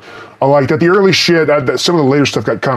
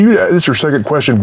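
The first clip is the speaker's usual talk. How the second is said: usual speaking voice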